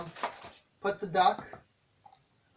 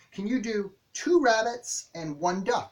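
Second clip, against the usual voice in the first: put-on slow question to child; 5 semitones higher, slower than usual. Shown as a demonstration. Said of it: "ask them".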